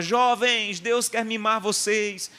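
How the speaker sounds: high, drawn-out mock rallying cry; stretched, high-pitched call to the youth; imitated shouting. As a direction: imitate an excited singer calling out to a huge crowd. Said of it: "E ela gritava".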